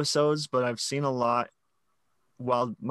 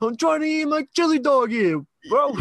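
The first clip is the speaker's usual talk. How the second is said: high, loud New York street guy complaining; hammed-up New Yorker guarding his chili dog; mock New York accent; 14 semitones higher, 6 dB louder than usual. Spider-Man messes up a guy's order, mock New York accent. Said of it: "like you're from New York".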